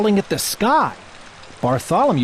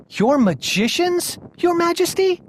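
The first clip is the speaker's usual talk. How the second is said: shivering page; 12 semitones higher than usual. A narrator's reading. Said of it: "Bartholomew shivered".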